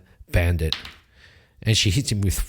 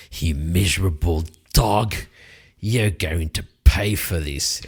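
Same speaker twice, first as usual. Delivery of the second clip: a touch lower, wounded threat; lightly voiced angry knight; 5 semitones lower than usual. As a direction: threaten the one who cut him. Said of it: "He goes".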